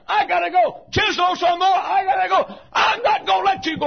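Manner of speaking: high, loud, pleading cry to God; desperate pleading. Acted. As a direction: beg him not to stop, shouting. Jacob pleading, wrestling with God.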